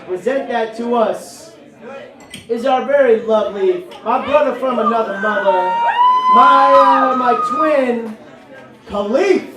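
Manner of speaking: crank it up to a big shout; hype-man shout. Real, not acted. Emcee hyping performer's entrance.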